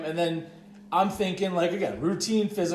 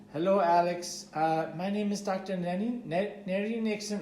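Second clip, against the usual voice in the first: put-on higher-pitched, botched Mexican accent drifting toward Indian; attempted Mexican accent; 4 semitones higher, 3 dB quieter than usual. Storyteller imitates the doctor introducing herself, botched Mexican accent. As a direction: try a Mexican accent and miss. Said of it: "I'm gonna do the accent".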